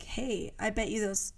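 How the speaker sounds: ordinary talk, nothing marked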